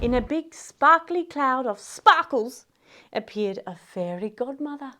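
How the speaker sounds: usual speaking voice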